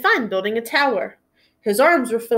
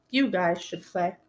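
quiet resignation; 3 semitones lower, 6 dB quieter than usual. Acted. Giving up his toys.

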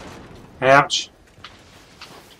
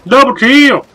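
excited shout; 10 semitones higher, 6 dB louder, slower than usual. Real, not acted. Excited game callout.

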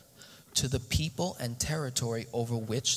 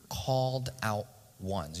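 slow dictation. Shown as a demonstration. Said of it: "You can write that".

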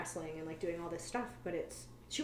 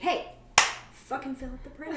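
louder and higher, a laughing stern scold; 6 semitones higher, 7 dB louder than usual. Retold or imitated. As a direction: snap at a coworker, half joking. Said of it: "then you can be like".

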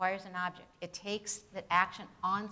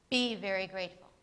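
Be very grateful as a firm command, a touch louder, falling; firm imperative, not a statement; 6 dB louder than usual. Shown as a demonstration. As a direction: give it as an order, stress on Be. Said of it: "Listen to this imperative".

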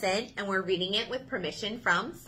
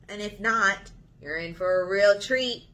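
plain reading voice

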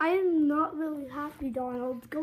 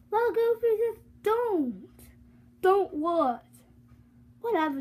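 ordinary delivery

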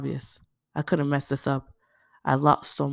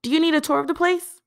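high, louder cheerful question; Aisha, eager offer; 13 semitones higher, 7 dB louder than usual. Acted. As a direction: offer a tour brightly.

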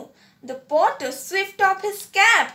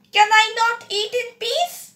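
higher, louder, fast irritable call; irritable outburst; 6 semitones higher, 3 dB louder, faster than usual. A narrator's reading. Sheriff interrupted at his meal calls out irritably. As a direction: snap it out, fed up with the noise. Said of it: "he called out irritably".

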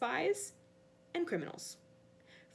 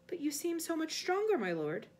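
higher-pitched, placating underling; nervous flattery; timid servant; 6 semitones higher than usual. Acted. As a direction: appease your master meekly.

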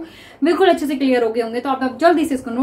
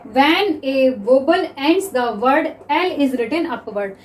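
slow, dictating the rule; slow dictation; much slower than usual. Shown as a demonstration. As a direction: read the rule slowly for notes. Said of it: "reads rule slowly". (धीरे-धीरे नियम लिखवाना)

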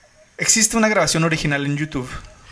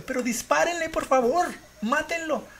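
desperate high plea; 11 semitones higher than usual. Retold or imitated. Caller begging police to kill the chimp, desperate. Retold frantic 911 call.

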